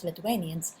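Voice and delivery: ordinary talk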